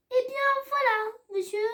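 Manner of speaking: high elf voice made solemn; a little creature trying to sound serious; high-pitched elf, gravely. A narrator's reading. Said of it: "répondit l'elfe avec gravité".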